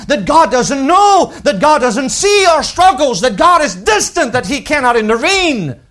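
high, loud fervent pulpit voice; rising pulpit fervour; impassioned preaching. Acted. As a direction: preach it out full force. Preacher builds to full force.

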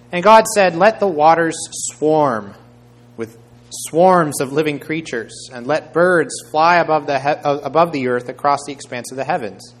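scripture proclamation. A narrator's reading. Preacher reading God's command over the waters, loudly proclaimed.

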